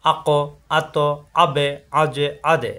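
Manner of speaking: আক, আত, আবে, আজে, আদে each ending in a bounce; qalqalah bounce. Shown as a demonstration. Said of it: "এভাবে".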